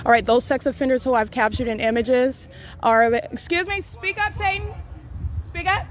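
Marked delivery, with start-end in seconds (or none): 3.1-5.9 pitched way up, softer, goading; taunting dare. Real, not acted. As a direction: dare Satan to speak up. Taunting an unseen demon.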